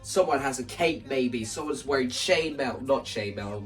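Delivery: ordinary delivery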